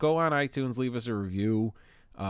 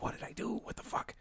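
whispered panic; 8 dB quieter than usual. Retold or imitated. Caller says police, panicked whispered thought.

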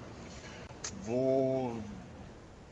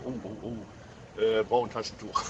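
mock teary sigh; 3 semitones lower, 5 dB quieter than usual. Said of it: "erwischt man sich selber und sagt so".

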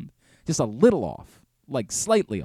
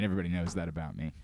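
usual speaking voice

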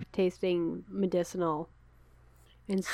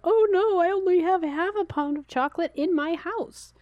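high, loud mock dismay; 11 semitones higher, 3 dB louder than usual. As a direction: squeak it out in mock panic. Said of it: "I'm like".

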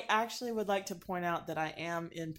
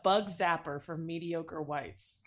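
ordinary talk

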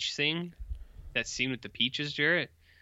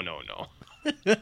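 laughing no; 3 semitones higher than usual. Real, not acted. Laughing refusal.

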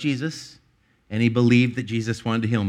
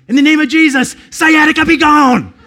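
imitated scream; 13 dB louder than usual. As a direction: belt out a preacher's rebuke at a pain. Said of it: "he screamed at me".

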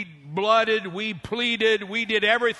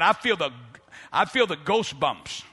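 usual speaking voice

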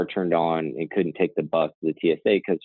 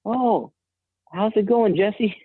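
greeting the TSA officer he suddenly recognizes, much higher and delighted; surprised greeting; slower than usual. Retold or imitated. Narrator greets former client at airport, high surprised delight. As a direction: pitch jumps way up in happy surprise. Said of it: "And I go".

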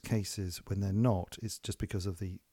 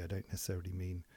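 ordinary talk, nothing marked

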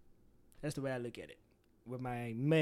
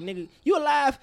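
high, loud mock surprise; friend checking in; 10 semitones higher, 9 dB louder than usual. Friend calling to check he is alive, loud and surprised. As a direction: bursts out like a friend who had given him up.

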